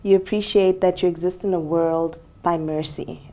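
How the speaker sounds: ordinary delivery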